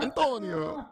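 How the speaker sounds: hammed-up Italian accent; Italian-sounding accent. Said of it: "That was more Italian".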